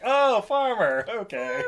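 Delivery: high, slow drawn-out oh of recognition; dawning realization. Retold or imitated. Realizing the new king is Farmer, slow and high. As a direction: let it dawn on you slowly. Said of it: "I'm like".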